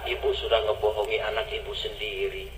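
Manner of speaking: hushed, saddened exclamation; soft, low rebuke. Acted. Umar gently rebukes the mother.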